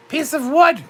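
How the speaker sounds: ordinary talk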